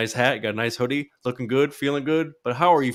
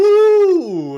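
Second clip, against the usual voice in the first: high ooh; 9 dB louder than usual. Acted. Playful high exclaim at intro.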